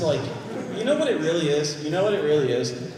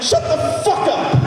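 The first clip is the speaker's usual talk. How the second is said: mock yell; 10 semitones higher, 5 dB louder than usual. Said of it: "Valve is like".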